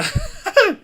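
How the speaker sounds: high laugh breaking the words; bursting laugh. Real, not acted. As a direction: crack up mid-line. Laughs at own question.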